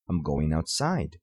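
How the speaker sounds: "outside" falls low to close the statement; falling intonation. Shown as a demonstration. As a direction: finish "I'm going outside." with a falling voice. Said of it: "nós baixamos a voz no final da frase". (entonação decrescente em "outside")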